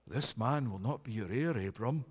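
low, soft divine voice; voice of the Lord. Acted. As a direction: speak as the Lord, deep and hushed. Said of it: "The Lord says".

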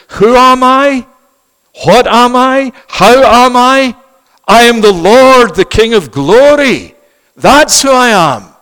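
high, loud, majestic divine voice; voice of the Lord. Acted. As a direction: speak as the King of glory himself. Voicing the Lord's reply.

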